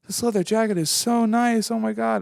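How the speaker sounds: high, gushing imitation of a lady. Lady at the pop-up gushing to the speaker about the jacket, high and excited. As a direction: coo over the jacket like a delighted stranger.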